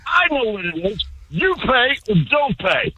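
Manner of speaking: worked-up seller standing firm; heated retort. Real, not acted. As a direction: push back hard in a haggle. Caller argues back over price.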